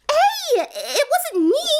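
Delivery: high, giggly protest; laughing denial. A narrator's reading. Pinkie denying the bat is hers, laughing.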